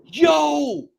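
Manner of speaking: high, loud call; shouted yo. Retold or imitated. Retelling his reaction to the cohost, a loud high yo. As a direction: throw it out as a shout. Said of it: "I'm like".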